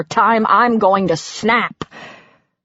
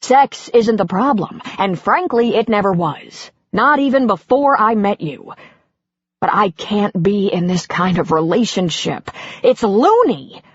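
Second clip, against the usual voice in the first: husband ranting about his wife's rules; 2 semitones higher than usual. Acted. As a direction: let it all boil over. Husband continuing rant.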